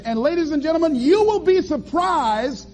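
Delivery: ordinary delivery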